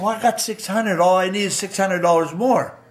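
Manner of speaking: retold plea, high and loud; insistent pleading. Son asking his father for car money, insistent retold plea.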